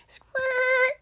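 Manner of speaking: high-pitched imitated rewind squeal; tape rewind noise. Mimics tape rewinding after a slip.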